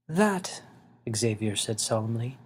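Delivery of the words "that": higher, gentle elder voice; a small old man asking kindly; little winged man. Acted. Xavier's voice.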